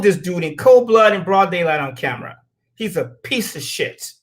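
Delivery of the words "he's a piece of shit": low, slow mutter of disgust; contemptuous mutter. Real, not acted. To the listeners about the killer, a slow contemptuous mutter. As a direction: spit it out under your breath, slow.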